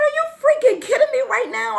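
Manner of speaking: high, fast, mock outrage; retold incredulous protest. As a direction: rattle it off in disbelief.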